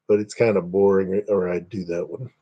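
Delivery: ordinary talk, nothing marked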